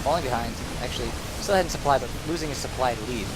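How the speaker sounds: ordinary delivery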